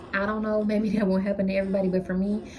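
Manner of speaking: usual speaking voice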